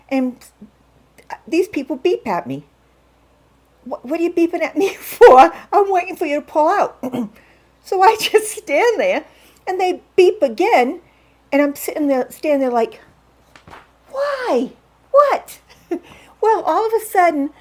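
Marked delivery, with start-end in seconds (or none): 3.8-7.0 high, loud, incredulous protest with a laugh; laughing disbelief at the honking; exasperated retort. Retold or imitated. Driver beeps at her, she snaps back incredulous. 14.1-15.6 much higher, puzzled questioning; bewildered at the beeping; baffled. Retold or imitated. Standing behind the car, baffled by the honking.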